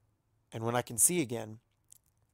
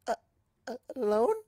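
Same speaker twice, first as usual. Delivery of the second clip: much higher stammering question; nervous; 10 semitones higher than usual. Acted. Azalea alarmed at tea alone with Celestia, nervous. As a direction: gulp it out.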